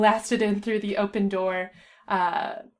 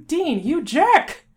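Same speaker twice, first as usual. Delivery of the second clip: loud, high, drawn-out indignant call; slow, stretched insult hurled across a room; calling after someone; 8 semitones higher, 8 dB louder, much slower than usual. A narrator's reading. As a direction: yell after someone walking off. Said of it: "Sam called after him".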